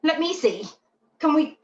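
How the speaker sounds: a dog pestering its owner to see the new thing; eager dog voice. Retold or imitated. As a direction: play the nosy dog who wants a look. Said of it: "these dogs then start to say".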